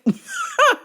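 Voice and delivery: very high peal of laughter; shrieking cackle. Real, not acted. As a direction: cackle in a piercing falsetto. Laughs after hanging up.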